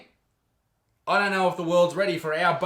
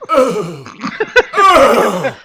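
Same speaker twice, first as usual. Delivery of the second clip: mock moaning; 6 semitones higher, 14 dB louder than usual. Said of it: "you look at her going".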